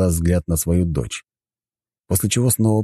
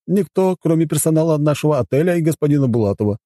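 high-pitched formal butler explaining house rules; courteous butler; 12 semitones higher, 3 dB louder than usual. Acted. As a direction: present the hotel as its dutiful butler. Voices the butler. (учтивый дворецкий)

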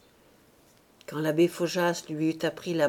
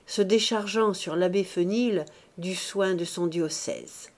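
plain reading voice

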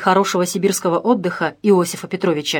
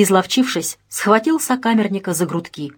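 ordinary delivery, nothing marked

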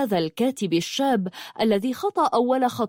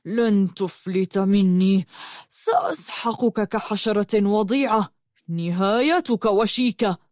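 slow menace through gritted teeth; seething rage. A narrator's reading. Al-Barq threatening to crush Yusuf, seething through gritted teeth. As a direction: threaten him with contained fury, drawing the words out. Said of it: "وقال بغيظ وهو يصر على أسنانه".

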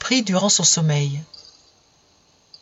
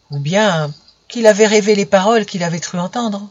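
usual speaking voice

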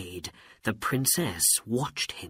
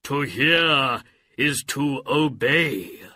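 booming, slow genie voice; 3 semitones higher, 5 dB louder, slower than usual. Acted. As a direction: speak as a grand genie. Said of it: "said the genie".

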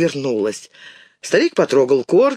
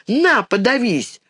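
put-on higher, drawn-out angry child's cry; indignant girl, pitch raised; spiteful retort; 6 semitones higher, slower than usual. A narrator's reading. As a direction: hurl the words at him. Girl hurls crusts at offender.

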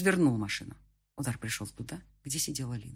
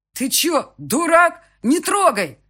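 much higher, much louder panicked yell; 14 semitones higher, 12 dB louder, much slower than usual. A narrator's reading. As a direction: scream at him to stop. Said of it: "заорал Андрей". (заорать в панике)

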